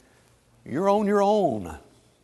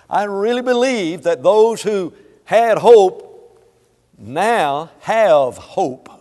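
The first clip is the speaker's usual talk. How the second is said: loud, slowing preacher's swell; preaching climax; 4 semitones higher, 12 dB louder, much slower than usual. Acted. Pastor promising hope to the congregation, loud and slow. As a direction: build to a booming close.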